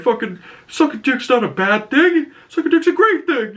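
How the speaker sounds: very high mock voice, cracking into a laugh; a squealing proud defence; proud Peacemaker. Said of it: "He's like".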